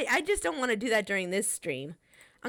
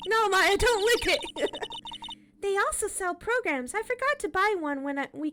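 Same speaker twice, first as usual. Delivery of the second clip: put-on higher, bubbly teenage girl voice, teasing; Maya character voice; 11 semitones higher than usual. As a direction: play the cheeky girl sidekick, pitched up. Voicing characters, high.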